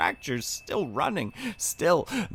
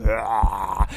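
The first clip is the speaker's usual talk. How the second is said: mock scream; 6 dB louder than usual. Narrated scream.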